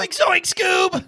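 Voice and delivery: high, loud, slow cartoon Shaggy yelp. Imitating Shaggy for a co-host, high loud cartoon voice. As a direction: squawk it like the cartoon stoner. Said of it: "As the voice of Shaggy".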